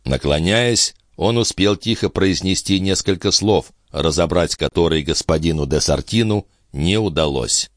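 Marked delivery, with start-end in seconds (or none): none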